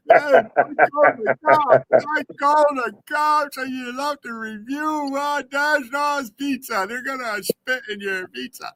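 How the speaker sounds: high, drawn-out mock wail; mocking put-on voice. Billy ridiculing a man who called the cops, high mocking voice. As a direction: stretch it out high in mockery.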